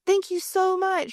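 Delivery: put-on high, slow, breathless little girl's thank you; gushing kid thanks. Mom imitating her daughter thanking her for cake, high and drawn out. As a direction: gush it like a delighted child.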